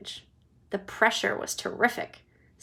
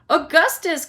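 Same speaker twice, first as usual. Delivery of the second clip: high, loud call; father crying out loudly; 7 semitones higher, 8 dB louder than usual. Acted. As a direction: shout his name. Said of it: "cried Mister Gloop".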